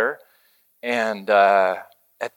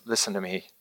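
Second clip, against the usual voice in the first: much softer, close and intimate; hushed; 4 semitones lower, 11 dB quieter, faster than usual. Pretend. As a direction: lean in and speak softly. God speaking intimately.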